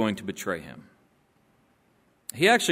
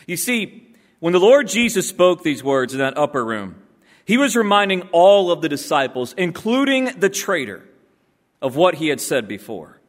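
ordinary talk

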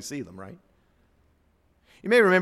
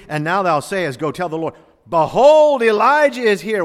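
higher and louder, peaking on behold; building proclamation; 6 semitones higher, 12 dB louder than usual. Shown as a demonstration. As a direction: cry out behold like a herald. Third reading, building to proclamation.

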